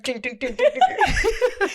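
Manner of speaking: news jingle imitation. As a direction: mimic a news sound effect.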